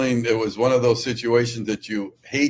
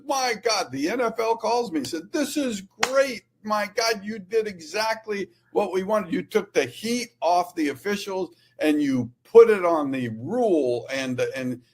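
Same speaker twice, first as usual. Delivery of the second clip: delighted league official; 9 semitones higher than usual. Retold or imitated. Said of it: "the league calls me on Monday and says".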